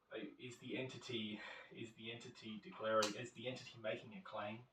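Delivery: low, soft official monotone, lightly acted; bureaucratic questioner. Centrelink officer asking the claimant intellectual questions, lightly acted bureaucratic drone. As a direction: drone it like someone reading off a form. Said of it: "horribly intellectual questions".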